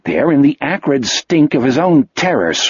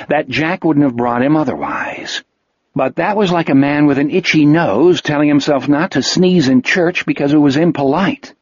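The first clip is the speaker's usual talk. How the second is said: ordinary delivery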